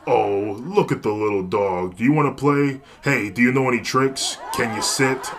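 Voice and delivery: loud, teasing talk to a dog; mocking baby talk. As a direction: talk down to her like a puppy. Thug mocks her like a dog.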